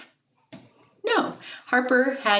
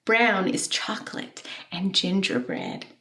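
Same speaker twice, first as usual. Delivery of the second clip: hushed whisper of a treat. Acted. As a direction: breathe it out like a secret. Whispered line in poem.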